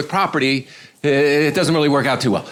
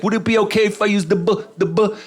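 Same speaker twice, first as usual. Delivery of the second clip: higher-pitched stammer that never finishes the word; mock stutter; 6 semitones higher than usual. Man asking for the bathroom, mock stutter. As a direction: get stuck on B and give up. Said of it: "the stutter kept getting worse and worse".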